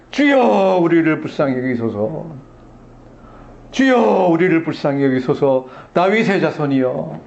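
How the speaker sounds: crying out. Acted. Blind beggars to the passing Messiah, crying out. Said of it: "울부짖어서 얘기하는데".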